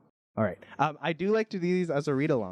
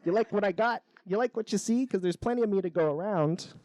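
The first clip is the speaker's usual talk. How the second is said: high-pitched flirty extrovert flower; show-off flower; 7 semitones higher than usual. Acted. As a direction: show yourself off to the bees, pitched up. Extrovert flower shows off to bees.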